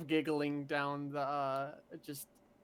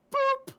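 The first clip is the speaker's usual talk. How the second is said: high squeaky boop; 8 dB louder than usual. Pretend. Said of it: "I'll fly up to his nose and go".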